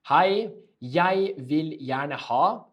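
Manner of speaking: slow, drawn-out polite order; slow model phrase. Shown as a demonstration. Teacher modelling the phrase, slow and drawn out. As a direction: stretch each word for learners. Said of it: "learn this one".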